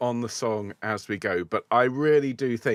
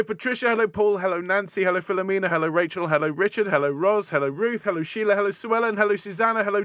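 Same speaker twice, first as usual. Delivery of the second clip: rapid, higher run of hellos without pausing for air; one-breath roll call; 6 semitones higher than usual. Shown as a demonstration. Choir leader greeting the live chat in one breath, rapid and breathless. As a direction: race through every name on a single breath. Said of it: "see how many people I can say hello to in one breath".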